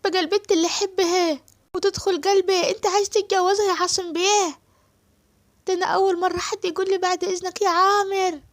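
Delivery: high, loud complaining servant voice; jealous servant boy. Acted. Amer protesting that Asem wants to marry Suad, high jealous whine.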